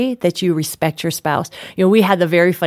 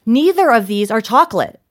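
higher, emphatic mock outrage; retold indignation; 4 semitones higher than usual. Wife retelling her complaint about chocolate, playfully indignant. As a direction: spell out the grievance with hammed-up indignation. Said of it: "So I'm just mad".

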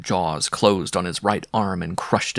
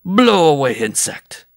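loud, high burst, then falling off; bellowing; 8 semitones higher, 6 dB louder than usual. A narrator's reading. Bareback rider blows the dwarf away, loud bellow. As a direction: bellow the dismissal, stretching the first words. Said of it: "he bellowed".

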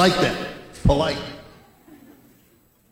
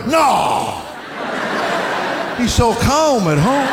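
mock surprised denial; 5 semitones higher, much slower than usual. Parent's sarcastic reply.

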